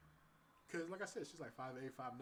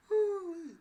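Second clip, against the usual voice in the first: loud high whoop; 19 dB louder than usual. Real, not acted. Exclaiming after the story.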